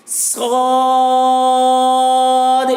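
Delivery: صاد stretched very long, heavy and loud; loud held letter. Shown as a demonstration. Teacher demonstrates a thick, hard صاد, loud. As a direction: boom out صاد slowly. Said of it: "صاد کو بھی زیادہ کھینچیں گے".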